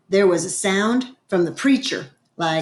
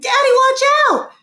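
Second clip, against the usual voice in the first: high, loud cry of alarm; screamed warning; 7 dB louder than usual. A narrator's reading. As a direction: scream it out to warn your dad. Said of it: "and I screamed".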